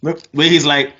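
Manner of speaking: normal talking voice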